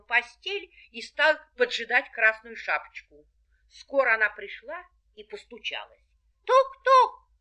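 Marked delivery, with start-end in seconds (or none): 6.4-7.3 high loud knock-knock; knocking sound. A narrator's reading. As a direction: knock knock called out brightly. Girl knocks on door.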